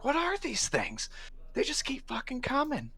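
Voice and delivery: high, panicked cry; panicked guard. Acted. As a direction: shout in disbelief.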